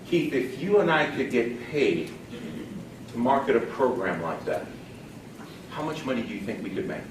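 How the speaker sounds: soft, low, relaxed pitch with pauses; chilled out. Shown as a demonstration. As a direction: ease into it quietly, no pressure at all. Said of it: "you just gotta chill".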